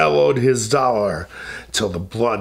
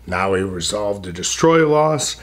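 ordinary delivery

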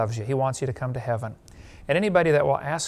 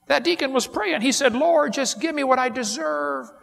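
alarmed retelling; 11 semitones higher than usual. Acted. Joke, preacher quotes deacon.

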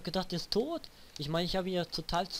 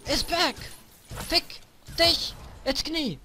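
high, loud raging yells; raging gamer; 11 semitones higher, 6 dB louder, slower than usual. Real, not acted. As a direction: rage at the game. Back in fight, raging.

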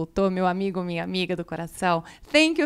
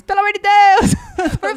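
loud, high begging cry; mock desperate plea; pleading shout; 11 semitones higher, 9 dB louder than usual. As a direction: beg them loudly, hammed up. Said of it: "pedindo pra vocês, pelo amor de Deus".

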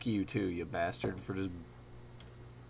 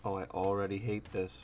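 ordinary delivery

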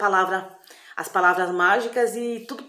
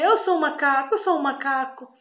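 put-on higher, dazed boy's voice; confused boy after the potion, higher voice; boy who thinks he's a monkey; 7 semitones higher, 4 dB louder than usual. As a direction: insist you're a monkey, pitched up.

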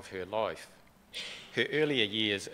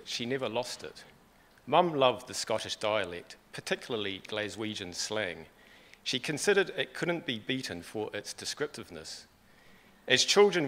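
ordinary talk, nothing marked

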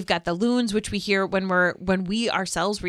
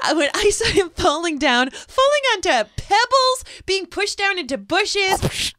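laughing; 9 semitones higher, 3 dB louder than usual. Real, not acted. Laughing at Percy being pushed.

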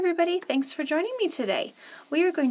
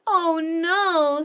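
alarmed; 5 semitones higher, 7 dB louder than usual. Acted. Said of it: "said the baby bird".

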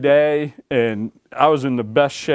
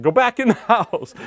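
urging; 9 semitones higher than usual. Retold or imitated. Signalling lady from truck.

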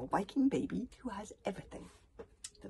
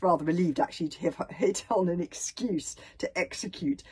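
plain reading voice

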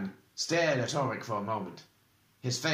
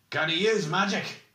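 high, insistent question; pointed demand; 7 semitones higher, 4 dB louder than usual. A narrator's reading. Oric questioning whether the prisoner can use magic, insistently. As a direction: press the question firmly. Oric's first, less forceful demand.

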